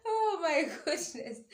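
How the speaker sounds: high laugh-cry through the words. Real, not acted. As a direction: laugh helplessly at your own story. Laughing at her own story.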